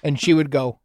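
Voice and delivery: ordinary talk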